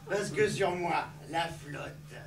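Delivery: low confiding. Acted. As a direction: drop the voice for the punchline. Punchline dropped low. (voix basse complice)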